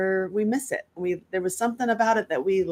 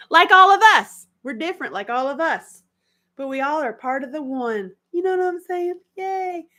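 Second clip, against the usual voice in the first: high sing-song; 9 semitones higher, 5 dB louder than usual. Acted.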